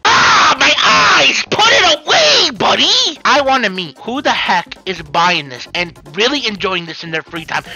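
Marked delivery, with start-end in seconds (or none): 0.0-3.2 high, loud anguished cry; mock horror. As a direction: wail as if the sight burns. Recoiling from the sight.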